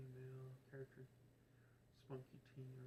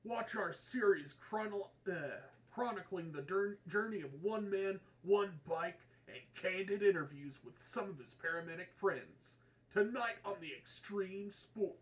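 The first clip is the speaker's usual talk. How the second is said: loud, high, hyped sports promo; 6 semitones higher, 18 dB louder than usual. Acted. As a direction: sell it like a blaring extreme sports trailer. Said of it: "Promo voice?".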